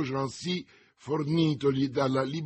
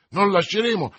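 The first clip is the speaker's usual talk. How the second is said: high, loud refusal; exclaimed objection; 8 semitones higher, 10 dB louder than usual. A narrator's reading. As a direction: protest with full voice. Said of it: "esclamò Porthos". (rifiuto esclamato a gran voce)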